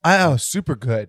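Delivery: dry sarcastic "super good". Real, not acted. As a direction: say it dripping with sarcasm. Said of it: "That was sarcasm".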